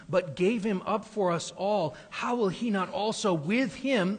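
loud, high, rising sermon climax; thundering preacher, slowing on the last words; preaching at full force. Acted. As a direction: build the Romans passage into a ringing proclamation. Reads Romans 8 building to climax.

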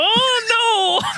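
high, drawn-out wail; hammed-up wail of dread; mock despair. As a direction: cry out as all is lost. Disaster hits in retelling.

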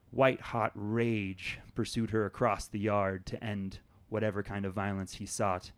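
ordinary talk

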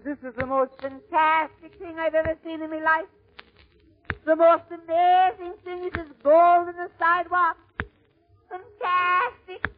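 very high, wonderstruck Irish voice; Irish immigrant's brogue. Acted. Irish newcomer finding money on the sidewalk, amazed Irish accent. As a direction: marvel in a high-pitched Irish brogue, stretching fantastic. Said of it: "this is an Irish story".

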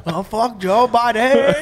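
slow laughing put-on North Korean accent; a hammed-up North Korean accent, laughing. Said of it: "Can you say that in a North Korean accent?".

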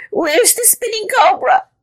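very high panicked squeal; frightened cry. A narrator's reading. Scared caterpillar looks for the cobra, crying out. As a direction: squeal it in hammed-up terror. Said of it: "he cried".

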